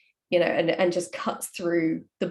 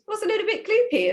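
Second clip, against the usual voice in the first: teasing question; 3 dB louder than usual. Retold or imitated. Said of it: "And I said".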